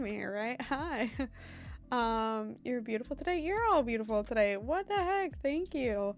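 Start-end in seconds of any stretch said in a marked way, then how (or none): none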